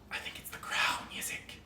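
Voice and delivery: sinister hushed whisper; creepy whisper. Acted. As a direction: lure him in a whisper. Snake whispers to Yizik.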